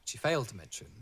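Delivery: normal talking voice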